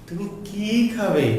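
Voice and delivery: slow, heavily stretched "কি", falling on "খাবে"; long কি. Shown as a demonstration. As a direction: hold the vowel of "কি", then let the verb fall. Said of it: "দীর্ঘ করে উচ্চারণ করতে হবে".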